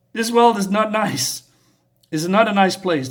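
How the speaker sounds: retold warning, pressed home loudly; emphatic warning. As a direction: drive the warning home to a child. Woman warning the girl.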